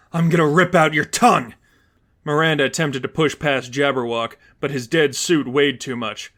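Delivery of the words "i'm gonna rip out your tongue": louder angry threat; mild threat. Acted. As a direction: snarl a threat at the hero. Villain threatens Stephanie.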